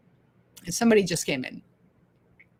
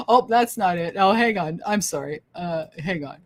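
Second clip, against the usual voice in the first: ordinary talk, nothing marked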